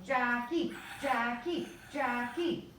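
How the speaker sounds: excited name chant, not a full yell. A narrator's reading. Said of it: "We all jumped to our feet yelling".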